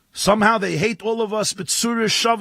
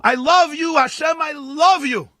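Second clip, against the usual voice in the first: screaming prayer; 5 semitones higher than usual. Acted. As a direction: belt it out to heaven, slowing on the key phrases. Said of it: "and he screams".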